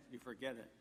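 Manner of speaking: ordinary delivery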